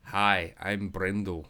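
amateur Scottish accent. Shown as a demonstration. Dad showing the kids on request, Scottish accent. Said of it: "do a Scottish accent".

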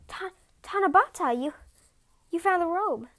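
high, loud, stumbling shock; stammering shock. Acted. Husband finding his wife in her robe, stammering in shock. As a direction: trip over the words in disbelief.

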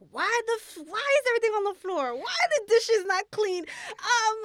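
put-on exasperated Haitian mom; complaining Haitian mother. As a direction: play a mother walking in and complaining about everything. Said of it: "the Haitian woman that walks in and complains".

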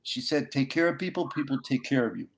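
high, choked-up tearful voice; fighting back real tears. Real, not acted. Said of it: "I got tears coming out of my eyes".

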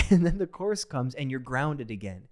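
normal talking voice

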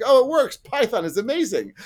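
high, excited mock relief; mock delighted learner. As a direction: squeal it like a learner amazed it finally works. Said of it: "they're like".

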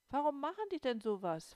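high, loud shocked question. A narrator's reading. Little girl shocked by the letter, high and loud. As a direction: cry it out in disbelief. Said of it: "fragte ich schockiert".

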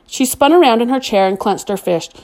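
plain reading voice

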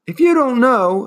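high, loud scream. A narrator's reading. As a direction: scream the question. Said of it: "Atreyu screamed even louder".

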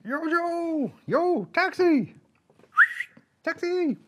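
high slow taxi call; hailing a taxi. Pretend. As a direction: call out like a New Yorker hailing a cab. Toy figure hails taxi.

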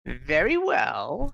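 slow, coldly amused ghost; a hint of a cold elf spirit; cold amusement. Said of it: "smiles with cold amusement".

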